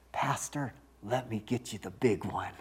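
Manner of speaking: hushed, conspiratorial friend at dinner; mischievous hushed aside; sly hushed offer. Retold or imitated. Friend slyly offers bigger steak.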